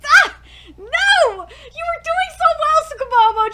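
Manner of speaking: high, loud despairing reproach; exasperated wail. Real, not acted. Fan wailing at the anime, exasperated. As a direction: cry out in frustration.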